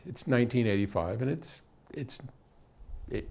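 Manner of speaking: usual speaking voice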